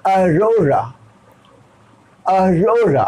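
"Arora" drawn out, long middle ro; slow model pronunciation. Shown as a demonstration. Teacher shows how to read it, "Arora" slowly stretched. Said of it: "fast में".

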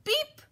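very high, short truck beep; friendly beep. A narrator's reading. Friendly truck greets the animals, high beep. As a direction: give a perky little horn beep. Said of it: "said the friendly Little Blue Truck".

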